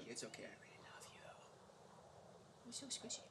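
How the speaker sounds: soft tender whisper. Real, not acted. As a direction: whisper it like comforting a pet. Cooing to a game character.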